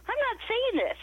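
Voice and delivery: high-pitched, incredulous protest; outraged disbelief. Retold or imitated. Refusing to say it.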